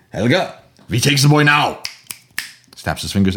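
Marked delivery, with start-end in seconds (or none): 0.1-1.8 put-on German-accented doctor calling his wife, higher and louder; bossy German doctor voice, higher. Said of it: "with his wife, Helga".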